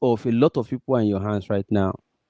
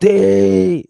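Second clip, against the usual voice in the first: high, drawn-out crowd cheer; imitated crowd yell; crowd shout; 8 semitones higher, 4 dB louder than usual. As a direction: shout a long Hey like a roused crowd. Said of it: "everybody will shout".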